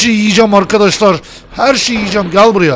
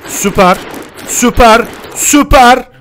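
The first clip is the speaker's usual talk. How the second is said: building cheer; 6 semitones higher, 5 dB louder, slower than usual. Real, not acted. Cheering a catch.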